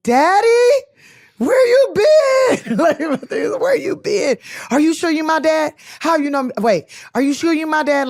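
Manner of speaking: high, loud, slow, breaking cry of daddy. Retold or imitated. Reliving the first call from her father, crying out loud. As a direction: wail it like a lost little girl finding her father.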